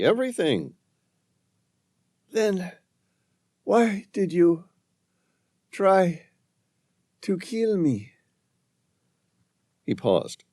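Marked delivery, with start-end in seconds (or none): 2.3-8.1 high, faint, pleading woman's voice; weak pleading woman. Acted. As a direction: plead weakly, near the end. Dying woman accuses.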